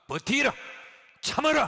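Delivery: high, loud barked order to endure; a tough adviser shouting "endure, bear it" at a sufferer; shouted command. Retold or imitated. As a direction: yell it like a drill sergeant. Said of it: "참고 견디라".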